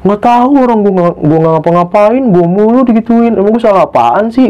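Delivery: high-pitched imitation of tearful protest; menirukan nangis kejer. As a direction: plead innocence like someone crying, lightly acted. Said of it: "nangis kejer". (menirukan keluhan sambil menangis)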